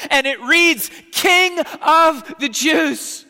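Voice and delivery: loud, high, ringing sermon; full-force preaching. Acted. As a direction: proclaim it to the whole church.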